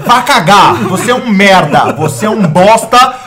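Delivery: loud, hammed-up cursing tirade; mock furious rant. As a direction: tell the cheater off at full volume, half joking. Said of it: "Hoje é noite do barraco mesmo". (bronca furiosa exagerada, bem alta)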